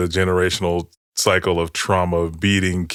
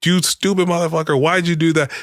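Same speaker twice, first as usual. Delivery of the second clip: high, strained mock yell at the kid; furious dad; 9 semitones higher than usual. Dad slamming son against the wall, high mock yell. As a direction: imitate dad's outburst, pitched high. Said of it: "he was like".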